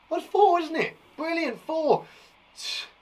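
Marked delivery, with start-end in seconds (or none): none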